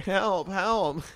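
high-pitched pretend plea; hammed-up, helpless calling; mock cry for help. Echoing the fallen climber.

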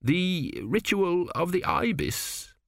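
high, slow, drawn-out moan. A narrator's reading. Flustered official clinging to his schedule, moaning. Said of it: "Kumi moaned".

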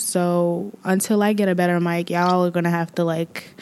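usual speaking voice

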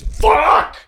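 high, loud burst of a curse; explosive high-pitched shout; sudden shout. Acted. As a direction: yell the curse out. Character curses in acted scene.

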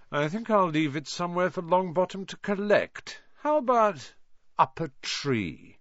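high, taunting suggestion; nasty smile. A narrator's reading. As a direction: toy with the listener, mockingly. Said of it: "Malfoy smiled nastily".

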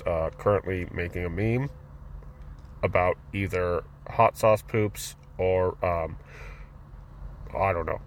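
low, mumbled agent reporting in; low droning surveillance officer with a muttered report; bored surveillance agent. Acted. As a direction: murmur like a government spy on a radio, unimpressed. Acts spies watching him.

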